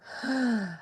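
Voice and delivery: one long, deliberate breath; demonstrated breath. Shown as a demonstration. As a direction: breathe audibly to show how. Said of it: "And then the breath".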